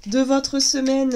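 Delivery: usual speaking voice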